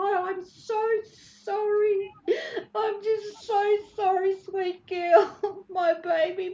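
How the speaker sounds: real tearful plea, pitched very high; weeping apology, words breaking up between sobs; crying. Real, not acted. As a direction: beg forgiveness through sobs, voice high and strained. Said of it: "I'm gonna cry".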